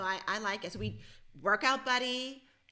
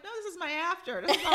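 laughing; 7 semitones higher, faster than usual. Retold or imitated. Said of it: "I'm like".

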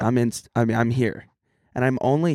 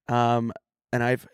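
ordinary talk, nothing marked

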